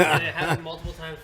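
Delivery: laughing through the words; telling the absurd delay while laughing. Real, not acted. Laughing at flight story.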